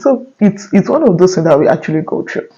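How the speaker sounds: ordinary delivery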